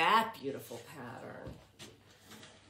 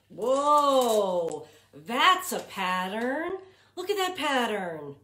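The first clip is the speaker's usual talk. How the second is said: loud, higher, drawn-out "whoa"; big awed exclamation to a class; excited wonder; 5 semitones higher, 9 dB louder, slower than usual. Real, not acted. Teacher shows a striking picture.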